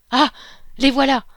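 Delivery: loud, high cry of surprise. A narrator's reading. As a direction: shout it as the boat appears. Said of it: "Il y eut un cri de surprise". (cri de surprise, fort et aigu)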